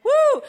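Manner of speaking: high loud whoop of joy; preacher whooping to the congregation; joyful woo. Real, not acted.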